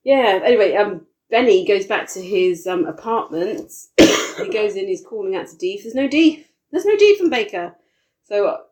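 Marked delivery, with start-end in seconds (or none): 5.8-7.8 higher and louder with alarm; rising alarm. Retold or imitated. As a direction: build the alarm, voice climbing higher. Retelling an empty flat.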